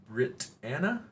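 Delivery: ordinary talk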